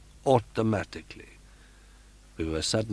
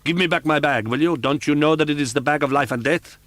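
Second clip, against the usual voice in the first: higher and louder furious demand; 8 semitones higher, 6 dB louder, faster than usual. Acted. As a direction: demand it back in a rage. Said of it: "the furious voice".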